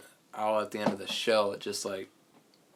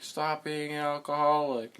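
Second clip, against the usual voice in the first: higher, louder put-on scolding; retold scolding; 7 semitones higher, 5 dB louder than usual. Retelling a sitcom to a friend, mock scolding. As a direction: imitate someone nagging a relative. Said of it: "He was just like".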